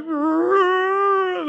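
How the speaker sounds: high, strained mock pig moan. Pastor imitating slaughter-bound pigs' agonized high moan. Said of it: "like they're moaning in agony".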